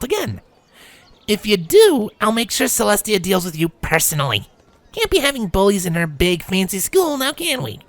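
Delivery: high, cocky filly warning bullies; brash young mare laying down the law; tough tomboy voice. A narrator's reading. Rainbow threatens the bullies.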